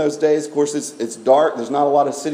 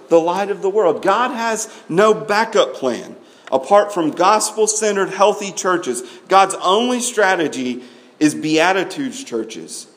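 ordinary talk, nothing marked

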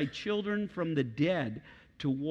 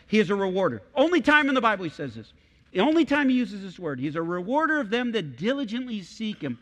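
loud, high, faster rising preaching; full-force preaching; 6 semitones higher, 6 dB louder than usual. Acted. Pastor thrilled by the Greek word, loud, high preaching. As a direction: lift the room with the discovery.

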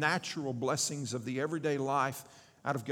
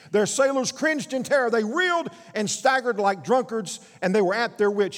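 louder, higher, urgent sermon reading; forceful preaching; 4 semitones higher, 6 dB louder than usual. Acted. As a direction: preach it out to the whole room. Dramatic scripture reading of storm.

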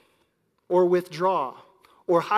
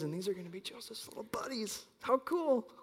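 excited whisper at the doorway; 4 semitones higher, 8 dB quieter than usual. Retold or imitated. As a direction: whisper it so the kid won't hear. Whispering at classroom door.

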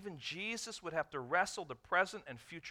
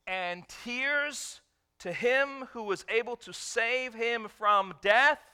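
slow, rising, louder preaching climax; building preacher cry; 7 semitones higher, 7 dB louder, slower than usual. Acted. Preacher to congregation, slow building cry. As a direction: swell the voice up on death.

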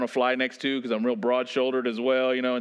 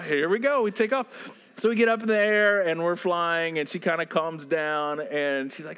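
ordinary talk, nothing marked

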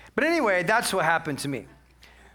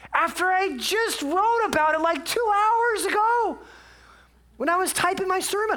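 incredulous outburst; 12 semitones higher than usual. Real, not acted. Preacher mocking himself for grumbling after writing about it, high incredulity. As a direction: push it up high in comic disbelief. Mock outrage at his own hypocrisy.

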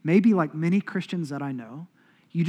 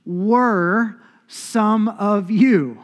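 slow, louder, lifted reading of Paul; slow, solemn, raised scripture recital; 3 semitones higher, 7 dB louder, much slower than usual. Acted. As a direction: declaim the verse slowly, each phrase weighed. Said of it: "He says".

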